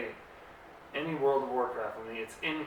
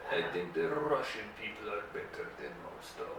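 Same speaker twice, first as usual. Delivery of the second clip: low, soft fake Russian accent; deep, hushed comic Russian accent; mock Russian accent; 4 semitones lower, 6 dB quieter than usual. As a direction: drop the pitch and put on a Russian accent. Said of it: "what it would be like to be Russian".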